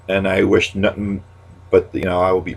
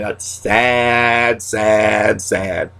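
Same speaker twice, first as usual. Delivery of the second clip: mock sad catchphrase; much slower than usual. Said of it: "my new saying".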